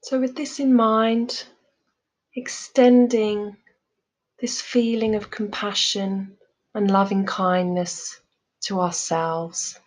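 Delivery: calm, measured meditation voice with long pauses; guided meditation. Real, not acted. Teacher leading listeners through loving kindness meditation, calm guided delivery. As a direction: guide them gently, leaving space after each phrase.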